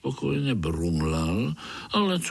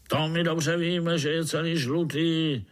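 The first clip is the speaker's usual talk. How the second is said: high overseer retort, only lightly roared; mild roar; 6 semitones higher than usual. A narrator's reading. Overseer answers the spider's excuse, mildly roaring. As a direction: cut back with a high, mock roar. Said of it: "zařval dozorce".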